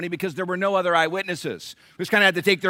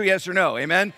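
normal talking voice

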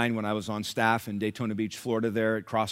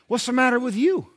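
much higher put-on voice of another patient; blunt challenger; 13 semitones higher, 7 dB louder than usual. Fellow patient confronting sour-faced Nelson, blunt high-voiced challenge. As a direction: challenge him in a high, acted voice. Voices man in next chair.

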